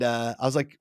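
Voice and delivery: ordinary talk, nothing marked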